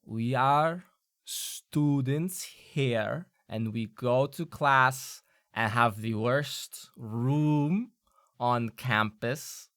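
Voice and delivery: very slow, deliberate flat-out statement; pair in unison. Acted. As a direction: spell it out very slowly together. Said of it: "and they go".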